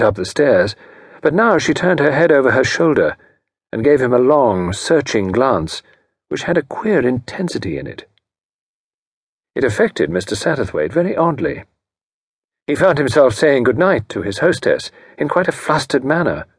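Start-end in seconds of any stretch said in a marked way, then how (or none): none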